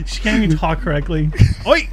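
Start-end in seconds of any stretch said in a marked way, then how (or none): 1.7-1.9 mock Irish oi. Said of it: "how to do an Irish accent".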